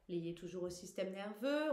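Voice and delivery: normal talking voice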